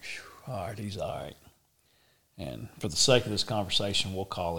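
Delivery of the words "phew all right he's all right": breathy whispered relief under the breath; relieved whisper. Retold or imitated. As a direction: sigh it out quietly, relieved. Relieved aside while riding.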